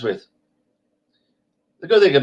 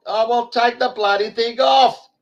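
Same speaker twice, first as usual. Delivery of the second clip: imitating the woman; 11 semitones higher than usual. Retold quarrel with masked woman.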